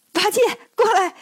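high-pitched calling out; cheerful call. A narrator's reading. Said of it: "笑着喊了声". (笑着高声喊人过来)

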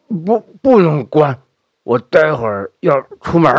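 put-on insistent old man, halting; stubborn old father. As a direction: dig in, refuse firmly. Stubborn sick father refuses.

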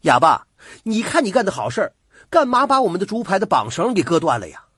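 loud, fast, high-pitched telling-off; furious young man demanding an explanation; angry scolding. A narrator's reading. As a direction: lay into him in a hot-headed rush. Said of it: "急吼吼的就骂".

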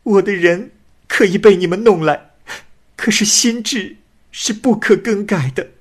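a forced bride swearing she stays true; tearful young woman. A narrator's reading. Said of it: "女子又说".

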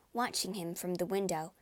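plain reading voice

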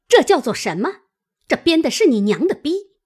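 loud, high-pitched abusive question; angry demand. A narrator's reading. Mother thrusts willow strips at her face, raging. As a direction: demand an answer, speeding up in fury. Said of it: "便走上来打耳瓜子， 骂道".

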